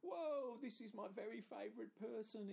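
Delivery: high, loud overjoyed dog; excited dog voice. Retold or imitated. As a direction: squeal it like a thrilled dog. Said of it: "it's like".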